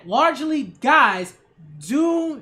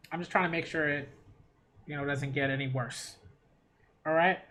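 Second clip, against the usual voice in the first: quiet, holding a nosebleed; talking through a nosebleed, softly; 8 semitones lower, 8 dB quieter than usual. Real, not acted. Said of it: "got this nosebleed".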